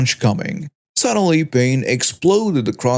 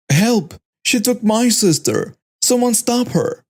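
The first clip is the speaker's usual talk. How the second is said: screaming for help; 9 semitones higher than usual. Acted. Teen calling to the mall crowd after the baby is taken, high panicked cries. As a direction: call out desperately, pitch climbing. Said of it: "I was screaming and crying".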